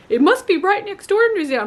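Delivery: high, loud mock-ignorant voice; scoffing high impression of careless people. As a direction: imitate clueless people, pitched high. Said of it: "people are like".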